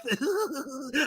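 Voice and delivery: high, put-on gloating giggle; mock villain snicker; gloating laugh. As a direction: laugh at someone's misery. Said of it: "so I could laugh".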